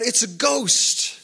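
mock cry of alarm, slow and high; retold fearful cry. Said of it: "they cried out ... in fear".

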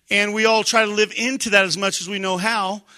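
ordinary talk, nothing marked

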